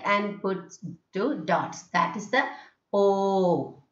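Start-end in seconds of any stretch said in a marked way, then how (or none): 2.9-3.8 "O" held long as "aww"; prolonged O. Shown as a demonstration. Teacher introducing the symbol with two dots, prolonged O. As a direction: draw out the vowel "O". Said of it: "the prolonging of O".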